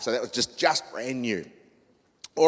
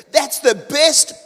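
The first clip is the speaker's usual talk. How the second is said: much higher, louder and slow; trucker fan; 13 semitones higher, 10 dB louder, much slower than usual. Retold or imitated. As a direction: stretch it out in the gushing caller's voice. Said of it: "He goes".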